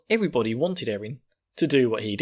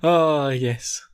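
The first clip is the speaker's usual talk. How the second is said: ordinary talk, nothing marked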